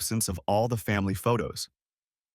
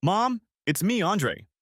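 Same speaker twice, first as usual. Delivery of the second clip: called out across the room, higher; calling out; 9 semitones higher, slower than usual. A narrator's reading. Son calling to his mother from the doorway, calling out. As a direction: call out to mom from the doorway. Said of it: "called out".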